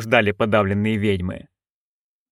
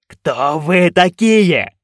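very slow loud bark; 4 semitones higher, 4 dB louder, much slower than usual. A narrator's reading. Director barking at the witches, slow and loud. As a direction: bark it like a drill sergeant. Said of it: "рявкнул он".

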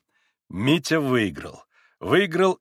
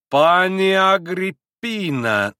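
high, loud, drawn-out protest; indignant address; 6 semitones higher, 6 dB louder, much slower than usual. A narrator's reading. Little pan starting to protest to Grushenka, indignant. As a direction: stretch the name in outrage.

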